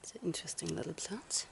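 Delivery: soft whisper, no voice. Real, not acted. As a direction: whisper it close to the mic. Quiet aside on the trail.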